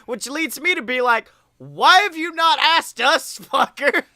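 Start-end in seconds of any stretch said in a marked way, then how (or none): 1.6-4.0 mock indignant. Mock complaint to friend.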